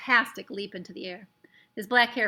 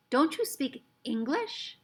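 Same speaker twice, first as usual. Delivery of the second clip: puzzled question; 5 semitones higher, slower than usual. Acted. Omri puzzled by foreign words.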